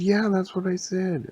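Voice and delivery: high-pitched exasperated character voice; exasperated insistence. Acted. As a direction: insist in a high, put-upon voice. Acted bickering between story characters.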